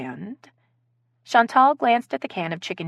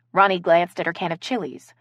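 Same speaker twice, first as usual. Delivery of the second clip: plain reading voice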